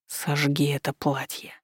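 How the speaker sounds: slow and soft, close to the ear; murmured softly into her ear; low in the ear. A narrator's reading. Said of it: "раздалось в ухо".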